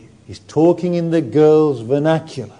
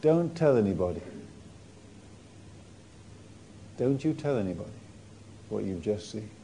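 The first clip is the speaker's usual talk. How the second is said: softer, lower, secretive; Jesus quietly telling them to keep the miracle secret; hushed warning; 7 semitones lower, 14 dB quieter, faster than usual. Acted. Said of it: "Jesus said".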